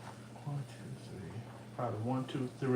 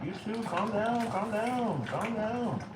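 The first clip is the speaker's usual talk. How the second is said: high playful calming; 8 semitones higher, 6 dB quieter, slower than usual. Pretend. Mock calming, answering self.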